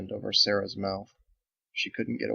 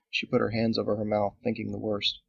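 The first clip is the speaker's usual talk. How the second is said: usual speaking voice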